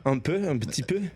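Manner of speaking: French. Pretend. Guest echoing the host in teasing French. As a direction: tease back in French. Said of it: "Vous parlez français".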